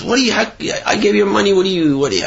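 mock frantic sputter at the thief; upset outburst. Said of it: "the guy got all upset and he started screaming".

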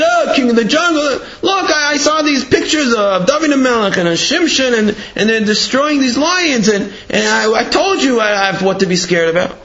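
high, agitated retelling, easing down; scared protest. Acted. Scared cub reporting the pictures to Dad, agitated. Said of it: "He's all scared. He runs back home".

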